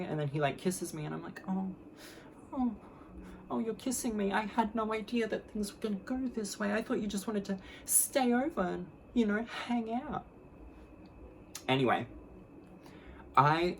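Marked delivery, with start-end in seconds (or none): 1.5-10.2 mock-innocent high voice; playing it cute. Being kissed by her date, playing it cute. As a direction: feign sweet surprise, high and airy. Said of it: "playing it cute".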